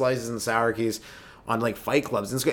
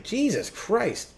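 exasperated; 9 semitones higher, much slower than usual. Retold or imitated. Exasperated onlooker.

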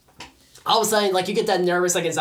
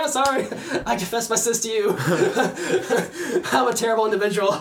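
laughing through a hammed-up apology; mock tearful confession; 3 semitones higher than usual. Guilty man confessing to the shoe owner, laughing mock-contrition. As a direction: confess it pitched up, breaking into laughs. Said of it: "feel going that compulsion cry almost".

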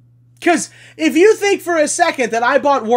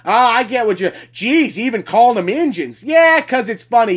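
usual speaking voice

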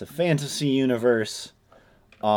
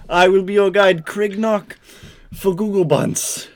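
mock fantasy guide; 8 semitones higher, 5 dB louder than usual.

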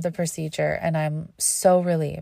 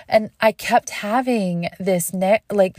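usual speaking voice

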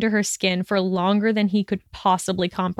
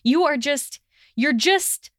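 higher, broken-off attempts at an insult; flustered anger; 7 semitones higher than usual. A narrator's reading. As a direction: start angrily and break off, lost for words. Furious, struggling for words in quarrel.

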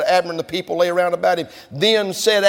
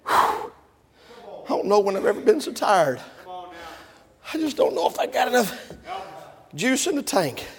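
worn-out David; 6 dB quieter than usual. Acted. Exhausted David to his men.

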